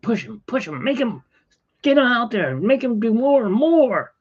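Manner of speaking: high-pitched mock slave driver; pushy boss. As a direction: imitate her relentlessly pushing him, voice climbing high. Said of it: "she just basically was just".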